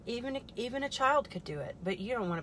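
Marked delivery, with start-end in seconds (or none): none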